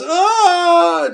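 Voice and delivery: long, high held scream; sustained "ah" of mock terror; frightened yell. [name] throwing himself back from the shark, frightened yell.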